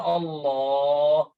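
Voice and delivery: "Allah" drawn out and held; overlong Allah. Shown as a demonstration. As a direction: stretch "Allah" as the wrong way. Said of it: "saking lama". ("Allah" sangat panjang)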